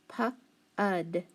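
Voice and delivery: slow "pu-ud", vowel drawn out; stretched sounding out. Shown as a demonstration. Teacher stretching the first syllable for students. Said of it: "So I have".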